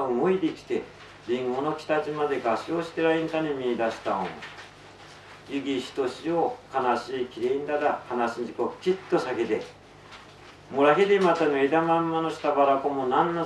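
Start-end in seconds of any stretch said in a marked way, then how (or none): none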